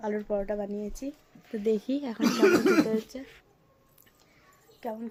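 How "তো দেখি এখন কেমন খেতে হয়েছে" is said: laughing. Real, not acted. Tasting her own paratha.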